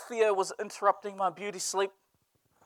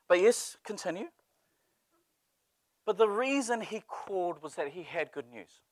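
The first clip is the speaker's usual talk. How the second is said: usual speaking voice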